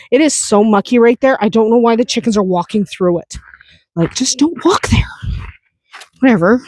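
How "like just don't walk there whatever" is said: whispered, exasperated aside. Real, not acted. Farmer whispers at chickens walking through mud, exasperated. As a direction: whisper it to yourself, fed up.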